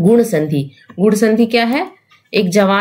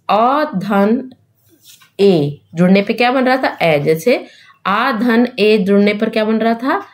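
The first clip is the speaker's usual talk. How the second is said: ordinary talk, nothing marked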